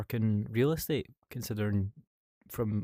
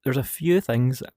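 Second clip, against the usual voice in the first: loud; 4 semitones higher, 11 dB louder than usual. Real, not acted. Stopping the alien mid-answer.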